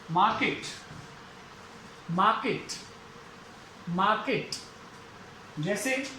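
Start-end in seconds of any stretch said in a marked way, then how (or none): 0.1-4.5 मार्केट said "maa-ket", no R sound; silent R in मार्केट. Shown as a demonstration. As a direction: drop the R in मार्केट, long "maa". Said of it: "आर तो आएगा ही नहीं".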